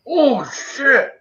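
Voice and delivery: high startled yelp of a curse. Retold or imitated. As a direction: blurt it as the heat hits. Guy tastes the spicy chunk.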